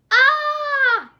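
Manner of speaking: high, loud pained yell; giant's yell. Acted. Giant lassoed by the nose, loud pained yell. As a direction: yelp as the rope yanks your nose. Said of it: "yelled the giant".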